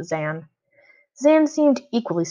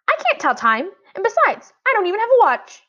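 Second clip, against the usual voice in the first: put-on much higher girl, matter-of-fact; little sister's voice; 13 semitones higher, 4 dB louder than usual. A narrator's reading. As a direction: explain like a small child. Violet answering brother.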